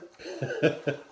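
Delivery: bursts into laughter; laughing. Real, not acted. Laughing at the imitation.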